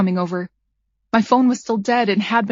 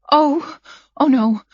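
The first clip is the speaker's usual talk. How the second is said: slow, stunned gasp of disbelief; shocked; 4 semitones higher, slower than usual. A narrator's reading. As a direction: breathe it out in shock. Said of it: "I said in shock".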